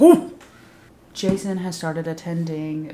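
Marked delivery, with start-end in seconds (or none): 0.0-0.4 high, loud whooping ooh; mock impressed "ooh". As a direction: burst out with a sudden scandalized ooh. Speaker acts out own reaction.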